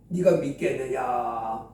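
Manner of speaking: deep, slow, weighty divine voice; voice of Jesus. Acted. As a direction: ask like a grave divine judge. Jesus asks the son.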